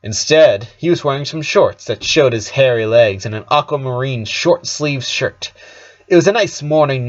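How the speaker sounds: plain reading voice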